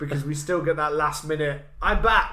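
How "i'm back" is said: loud, higher mock cry; mock triumphant return. Villain bursts back for one last attack, mock triumphant cry. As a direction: announce a sudden comeback.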